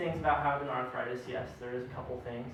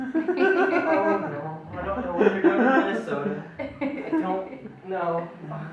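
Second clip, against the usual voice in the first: mock panic; 5 semitones higher, 9 dB louder, slower than usual. Panicking at friend with bra.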